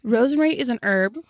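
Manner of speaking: "herb" with a silent h, as "erb"; American herb. Acted. Host restarts for American listeners, "herb" said as "erb". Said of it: "we're in America now".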